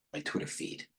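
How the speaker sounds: a soft whisper while searching. Real, not acted. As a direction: breathe it under your breath. Repeating phrase while searching screen.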